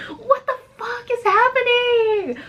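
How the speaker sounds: high drawn-out wail of disbelief; shocked scream. Real, not acted. Mock outrage reacting to own story.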